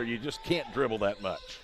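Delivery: ordinary talk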